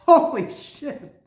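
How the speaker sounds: breathless joyful awe; awed and stretched. Real, not acted. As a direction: savour the disbelief. Overwhelmed by the find.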